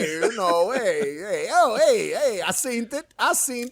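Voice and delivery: heavy New York street voice, rising hey's; imitating Eric's accent. Mocking a friend's thick New York accent to his co-host. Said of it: "He's got that".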